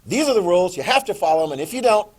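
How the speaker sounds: loud, stern parent voice; laying down rules. Acted. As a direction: lay down the law to a kid, loudly. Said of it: "they told the kid".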